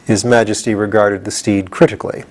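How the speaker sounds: usual speaking voice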